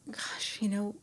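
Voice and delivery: hushed, breathless whisper to herself; whispered thought. Retold or imitated. Medium alone in the bathroom at night, whispering. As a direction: whisper it as a private doubt. Inner thought, doubting she dreams.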